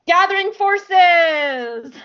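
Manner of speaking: slow, loud, high announcer boom; dramatic title call. Acted. Announcing the episode title to co-host, big trailer-style boom. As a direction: proclaim the title like a trailer voiceover.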